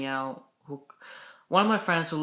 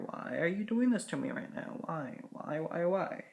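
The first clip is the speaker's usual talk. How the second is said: annoyed at hair; 3 semitones higher, 6 dB quieter than usual. Real, not acted. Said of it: "I'm just gonna fix my hair".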